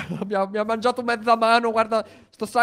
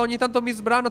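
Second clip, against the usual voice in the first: ordinary talk, nothing marked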